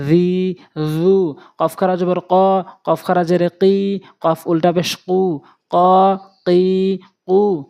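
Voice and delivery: slow drill with long vowels in য, কি, ঝু, হা; one-alif elongation. Shown as a demonstration. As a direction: hold each final syllable level, as in ঝা, ঝি, ঝু. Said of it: "এরপরে নিয়ম প্রয়োগ করে পড়ব".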